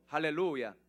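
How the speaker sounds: ordinary talk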